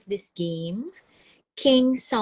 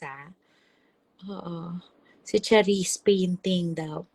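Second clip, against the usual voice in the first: usual speaking voice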